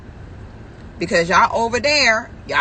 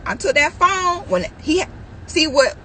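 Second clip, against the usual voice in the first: usual speaking voice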